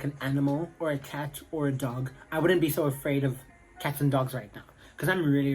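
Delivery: ordinary talk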